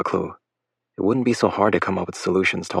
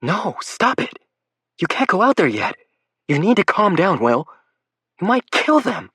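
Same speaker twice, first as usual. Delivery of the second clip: high, loud hammed-up pleading; mock pleading; 13 semitones higher, 3 dB louder than usual. Lake pretending to restrain Will for the boys, mock pleading. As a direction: play the frightened mother, over the top.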